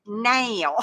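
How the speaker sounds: "now" stretched into "nayl"; imitated mock-Southern now. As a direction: imitate someone saying "now" like "nail". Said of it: "trying to sound Southern".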